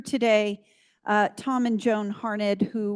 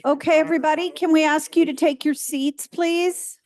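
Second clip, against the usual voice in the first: calling out; 5 semitones higher, 5 dB louder than usual. Real, not acted. Calling room to take seats.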